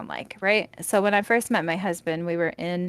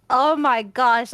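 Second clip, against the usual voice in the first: ordinary talk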